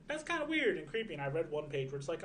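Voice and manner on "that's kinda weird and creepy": a much higher, weirded-out squeak; mild high disgust; creeped out. Retold or imitated. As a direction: recoil at something creepy. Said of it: "And I'm like".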